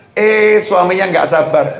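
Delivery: usual speaking voice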